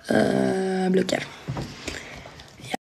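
ordinary talk, nothing marked

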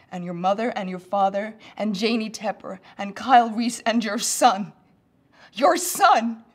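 building to a high, screamed climax; loud, escalating desperation; desperate shouted warning. Acted. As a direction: shout the list, rising to a frantic peak. Acted warning climbs to a climax.

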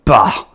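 loud dismissive scoff; scornful bah. Acted. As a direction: spit out a disgusted bah. Grandfather's scornful exclamation.

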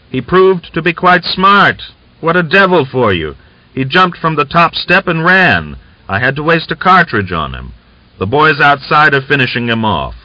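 high, loud, excited voice; excited report. A narrator's reading. Chumakov reports on killing the prisoner, excitedly. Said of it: "excitedly reported".

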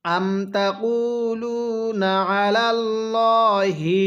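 drawn-out chanted recitation; slow melodic Quranic chant. Shown as a demonstration. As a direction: recite it like a tajweed teacher. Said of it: "কিভাবে পড়ব?".

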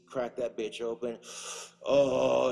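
ordinary talk

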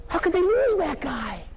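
slow, high-pitched mock dismay; incredulous lament. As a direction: wail it in theatrical disbelief. Acted outrage at losing Jacob.

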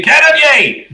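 very high, drawn-out holler; belted call. Acted. Sun summoning a crewmate below deck, belting it out. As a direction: belt out the name, held high then falling. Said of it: "you belt that out".

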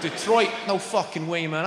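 ordinary talk

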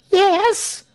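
very high, loud, impatient urging; eager prompting. A narrator's reading. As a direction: prod the speaker to go on. Crowd urges the pastor on.

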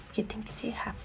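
urgent whisper; hurried hushed whisper. Real, not acted. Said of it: "eu já tô falando baixo".

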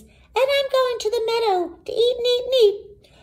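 very high, fast little goat; very small goat voice. A narrator's reading. Youngest goat telling troll his plans, very small voice. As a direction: squeak it quickly like a tiny kid goat. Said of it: "in his very small voice".

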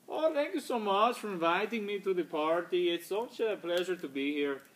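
put-on high-pitched, unplaceable foreign accent; Marcio. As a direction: play a co-host with a vague foreign accent. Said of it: "didn't know where his accent's from".

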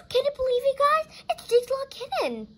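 high, breathless-with-news squeal; amazed excitement. Pretend. Reader tells viewers about the kitten, high excited voice. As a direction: share the big surprise with the audience.

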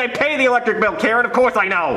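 exasperated retort. Retold or imitated. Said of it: "And I'm like".

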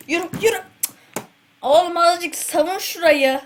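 high, loud frantic calls; frantic yelling. Real, not acted. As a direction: shout urgently at teammates. Frantic play, yelling at teammates.

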